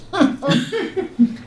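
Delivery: high chuckling laugh; amused laugh. Real, not acted. Cousin calls the ship a floating city, amused high laugh. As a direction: laugh at the quip, pitched well up.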